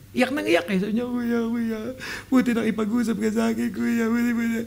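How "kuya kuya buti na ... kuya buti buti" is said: imitating a tearful plea, high and soft; higher-pitched pretend weeping; mock sobbing. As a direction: whine it out as if crying to a big brother.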